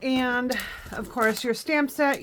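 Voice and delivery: normal talking voice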